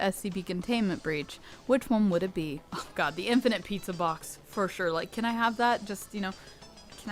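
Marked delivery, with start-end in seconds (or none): none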